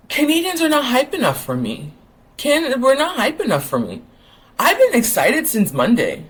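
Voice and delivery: pitched well up, venting that nobody's hyped; high, fired-up frustration; exasperated excitement. Real, not acted. Complaining to viewers that Canadians aren't hyped, high exasperated voice. Complaining fans lack enthusiasm.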